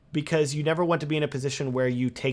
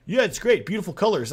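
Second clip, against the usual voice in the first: higher, louder carefree gush; mock breezy client; 6 semitones higher, 5 dB louder than usual. Imitating a careless client shooting too much footage, bright gush. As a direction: brush it off like a client who doesn't care. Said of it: "They're like".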